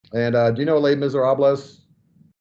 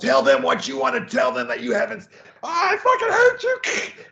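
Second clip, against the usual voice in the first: re-enacted high screaming outburst; mock enraged scream; 14 semitones higher than usual. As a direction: scream it out as if purging rage. Acts out workshop anger release.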